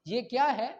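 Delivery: ordinary talk, nothing marked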